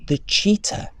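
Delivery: usual speaking voice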